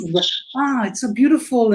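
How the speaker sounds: ordinary talk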